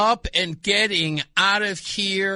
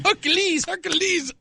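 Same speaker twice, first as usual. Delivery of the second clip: high, sing-song cheering wife; imitating his wife; 10 semitones higher than usual. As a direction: cheer him on like a doting wife. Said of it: "That's what she sounds like".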